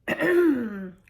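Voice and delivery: loud, pompous clearing of the throat; mayor calling the meeting to order with a cough. A narrator's reading. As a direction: clear the throat to get attention. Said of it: "coughed the mayor".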